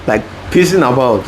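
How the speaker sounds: usual speaking voice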